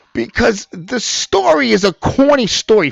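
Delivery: high, loud burst of aggravation; aggravated. Real, not acted. Commentator explaining why the story irks them, aggravated. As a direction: blurt out the complaint, loudly exasperated. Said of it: "You know why I'm aggravated?".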